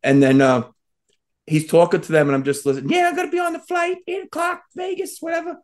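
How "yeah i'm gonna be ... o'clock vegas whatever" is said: very high caricatured phone voice; hammed-up high-pitched caller; Ernie. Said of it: "Ernie calls up".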